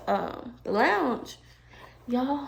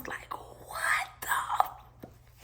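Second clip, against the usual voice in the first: stunned whisper; 11 dB quieter, much slower than usual. Retold or imitated. Stunned at the smashed car.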